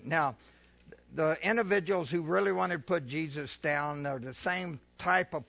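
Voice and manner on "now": ordinary talk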